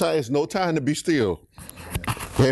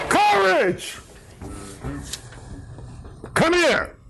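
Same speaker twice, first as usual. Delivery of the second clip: high-pitched, emphatic preacher's call; urgent, high exhortation; emphatic rallying cry; 4 semitones higher, slower than usual. Acted. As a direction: call it out to rouse a blind man. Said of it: "they said it with some enthusiasm".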